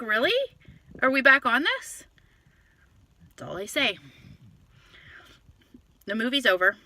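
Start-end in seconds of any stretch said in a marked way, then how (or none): 0.0-2.0 raised, rising disbelieving question; retold incredulity. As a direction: repeat it in high, louder disbelief. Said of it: "I'm like".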